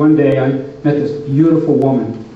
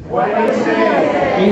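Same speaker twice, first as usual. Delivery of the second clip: slow drawn-out question; 5 semitones higher, much slower than usual. Acted. Far slow aside, repeated bit.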